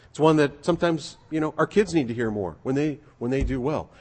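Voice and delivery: usual speaking voice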